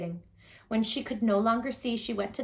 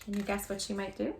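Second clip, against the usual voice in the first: ordinary delivery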